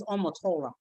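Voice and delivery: mimicking a Westernised way of saying "Omotola"; put-on foreign "Omotola"; imitated Oyibo pronunciation. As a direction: say "Omotola" as if trying to sound foreign. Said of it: "she's trying to sound Oyibo".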